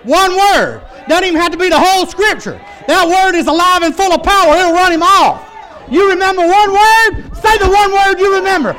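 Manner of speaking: high, loud preacher's shout; impassioned pulpit shouting; full-force preaching. Real, not acted. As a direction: drive it home at the top of your voice.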